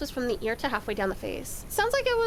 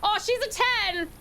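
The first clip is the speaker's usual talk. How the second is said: high, drawn-out gushing; mock admiration; 9 semitones higher, slower than usual. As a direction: imitate someone gushing over a catch. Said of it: "it's like".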